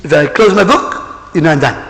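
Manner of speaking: ordinary delivery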